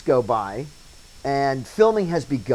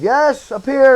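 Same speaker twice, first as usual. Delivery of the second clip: called out, higher and louder; calling back; 12 semitones higher, 10 dB louder, slower than usual. Retold or imitated. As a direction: shout back from up on the set.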